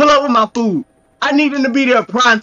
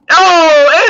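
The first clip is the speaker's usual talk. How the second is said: surprised oh; 6 semitones higher, 6 dB louder than usual. Real, not acted. Surprised by rain in clip.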